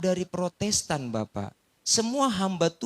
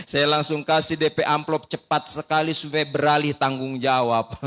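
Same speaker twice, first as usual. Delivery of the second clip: ordinary delivery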